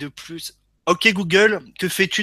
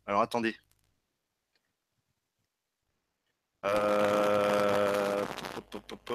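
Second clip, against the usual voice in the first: crackling mic; 9 semitones lower, 8 dB quieter, slower than usual. Real, not acted. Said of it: "il y a ton micro qui grésille".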